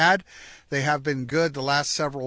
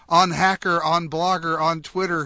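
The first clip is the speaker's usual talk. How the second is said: raised, louder calling-out within a verse; mild roar; 5 semitones higher, 4 dB louder than usual. A narrator's reading. Santa Geek activating his robot reindeer by name, mildly roared. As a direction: call out each name like a sleigh driver. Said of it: "he roared".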